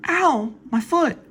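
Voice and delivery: very high, thin character voice; squeaky voiced woman. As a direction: pitch way up for the woman's line. Man voiced in read screenplay.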